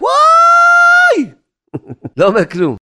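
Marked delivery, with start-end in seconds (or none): 0.0-1.3 long, high, loud wail of "vai"; wordless cry. Shown as a demonstration. Teacher shows students a cry without words, long high wail. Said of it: "צעקה אומר שצועק ואינו אומר כלום".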